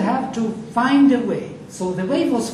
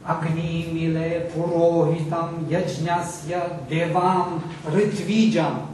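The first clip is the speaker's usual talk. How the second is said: Sanskrit verse recited in one continuous measured line, word accents marked; proper-accent recitation; 2 semitones lower than usual. Shown as a demonstration. Teacher reading the verse to show accent against rhythm, proper-accent recitation. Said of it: "if I read it as a proper accent".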